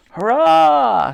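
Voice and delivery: loud, high, long hurrah; shouted cheer. A narrator's reading. Old soldiers celebrate the hit, shouted cheer. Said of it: "shouted the old soldiers".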